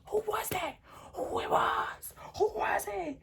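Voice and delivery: very high, strained mock shout; imitated angry demand. Boyfriend interrogating her about the text, imitated high angry demand. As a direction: hammer out his questions in a high yelp.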